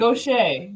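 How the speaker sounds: Gaucher said as go-SHAY; correct pronunciation. Shown as a demonstration. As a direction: say Gaucher go-SHAY, stress on the end. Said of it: "He just told us how to say it".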